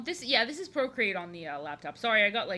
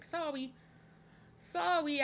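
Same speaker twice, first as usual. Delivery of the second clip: put-on cutesy toddler apology; 6 semitones higher, slower than usual. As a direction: apologize like a small child, playfully. Mock toddler apology about own voice.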